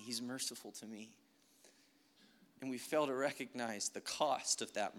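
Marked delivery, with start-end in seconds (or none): 0.0-1.1 "He's merciful to me" tossed away much softer; a careless, much quieter "He's merciful to me"; offhand murmur. Pretend. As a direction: shrug off the words "He's merciful to me" under your breath. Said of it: "we cheapen it because it's like".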